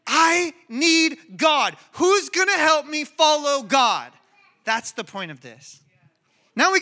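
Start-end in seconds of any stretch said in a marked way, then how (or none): 0.1-4.1 slow, emphatic acted cry, pitched higher; raised preacher's voice imitating people pleading. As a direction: cry it out as a desperate plea. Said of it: "they're crying out".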